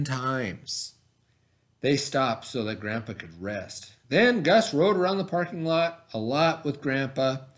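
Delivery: put-on reading a picture book aloud to children; story reading. A narrator's reading. Teacher reading a bike story to her class, storytelling voice. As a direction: tell it gently, like story time.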